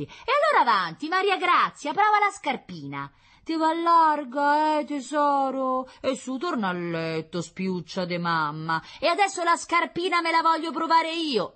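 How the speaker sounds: high, loud, theatrical concierge; brash concierge, defiant. Acted. Concierge sends her child to bed and takes over, brashly. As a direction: order the child about, then challenge loudly. Plays the sharp-tongued concierge.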